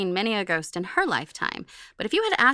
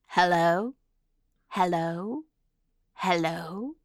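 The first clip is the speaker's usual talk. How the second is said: fading, rising ghostly hellos; man doing a woman's voice, eerie; eerie girl's voice; much slower than usual. Retold or imitated. Ghost voice retold again.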